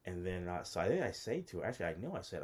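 normal talking voice

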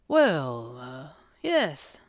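hesitant, halting agreement; awkward. A narrator's reading. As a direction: stall uncomfortably before agreeing. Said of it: "Abigail's father said awkwardly".

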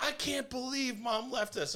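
high mock whine of the kids; high mock-betrayed daughters; whining girls. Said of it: "the girls are like".

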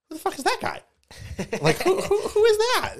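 very high, loud mock disbelief; exaggerated high-pitched bafflement; incredulous outburst. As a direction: act out a stunned, squealed demand. Acts out discovering stranger on board.

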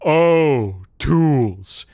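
sarcastic scoff. Acted. Big man mocks the tools excuse, sarcastic. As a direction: drip sarcasm.

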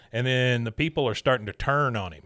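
ordinary talk, nothing marked

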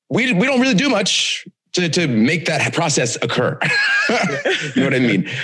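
laughing. Real, not acted.

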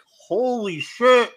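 high, slow and loud holy shit; stunned disbelief. Retold or imitated. Reacting to a shocking TV clip, slow loud disbelief. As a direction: stretch it out like your jaw just dropped. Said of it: "and I'm just like".

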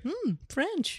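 high, soft swooning purr; mock a smitten, wide-eyed reaction; intrigued swooning. Host mimics co-host hearing French boyfriend, smitten. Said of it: "Your eyes got big".

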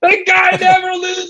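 high, shouted punchline; a comedian capping the joke. Acted. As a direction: belt out the tag line.

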